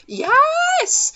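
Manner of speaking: a sudden high squeal of delight; excited yes. Real, not acted. Fan cheering as the couple finally gets together. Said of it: "Slow burn".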